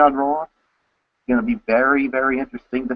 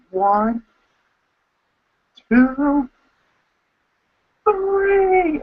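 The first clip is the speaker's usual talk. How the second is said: each count climbing higher into a shout; crowd count; 13 semitones higher, much slower than usual. Retold or imitated. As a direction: count along with the arena, pitch rising to a yell. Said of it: "I was counting with everyone going".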